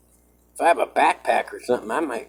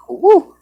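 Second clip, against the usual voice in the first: whoop; 16 dB louder than usual. Real, not acted. Whoop before climbing.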